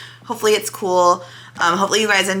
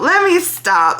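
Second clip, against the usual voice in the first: much higher and louder; laughing self-interruption; 11 semitones higher, 5 dB louder, slower than usual. Real, not acted. Catching herself craving a cigarette, she cuts herself off with a laugh. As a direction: cut yourself off with a bright laugh.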